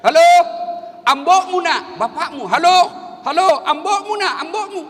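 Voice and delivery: high, loud hallo into a phone; retold phone shouting. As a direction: yell down the line to his son. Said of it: "Langsung dia teriak". (berteriak keras dan tinggi ke telepon)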